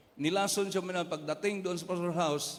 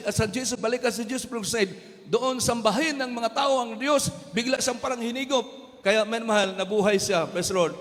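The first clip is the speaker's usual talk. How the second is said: loud, high revival preaching over music; fervent shouted sermon; full-force preaching; 4 semitones higher, 6 dB louder than usual. Acted. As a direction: proclaim God's will to the congregation.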